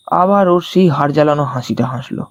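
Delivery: normal talking voice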